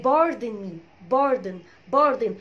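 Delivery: "pardon" stressed on PAR, weak second syllable; correct pardon. Shown as a demonstration. Teacher giving the phrase for say it again, "pardon" as PAR-dn. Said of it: "اسمها".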